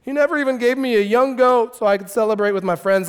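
high, mock-aggrieved whine; pathetic complaint. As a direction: rattle off the grievance in self-pity. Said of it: "this is the most pathetic verse".

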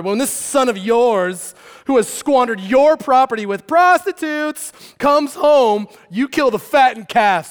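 loud, high, stretched-out grievance; indignant accusation. Acted. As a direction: throw each charge at your father. Older brother's angry accusation to father.

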